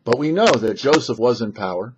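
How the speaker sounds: ordinary talk, nothing marked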